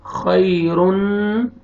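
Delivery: खैरुन drawn out very slowly with a long nasal hum on the न; slow nasalized खैरुन with ghunna. Shown as a demonstration. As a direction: hold the nasal sound in खैरुन. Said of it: "यहाँ पे गुन्ना करेंगे".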